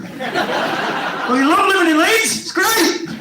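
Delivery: high-pitched hammed-up cheer; mock enthusiasm. Bloke puking into a bin to a Leeds local, mock enthusiasm. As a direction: gush in a put-on high voice.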